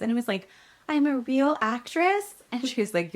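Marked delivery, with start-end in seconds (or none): 0.9-2.3 high, slow wondering question; awed disbelief. Retold or imitated. Actress hears she booked the series, awed disbelief. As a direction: rise high on it as if unable to believe it. Stunned disbelief at booking news.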